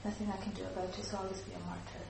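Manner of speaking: soft, resigned murmur, quickly; silent victim. Shown as a demonstration. Teacher voicing a silent victim giving up, soft and resigned. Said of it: "A silent victim".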